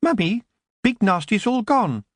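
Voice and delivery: put-on high small child's voice; a young child telling his mother the monster is gone; little boy. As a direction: pipe it up like a relieved little boy.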